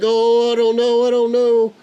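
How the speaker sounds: high, loud, slowed panicked cry; a terrified first-time pilot losing control; retold panic. Grandpa fighting crosswind on the runway approach, loud high panic.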